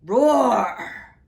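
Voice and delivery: huge loud dragon roar; the dragon roaring at the knights; dragon bellow. Acted. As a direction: bellow it like a beast. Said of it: "bellowed the dragon".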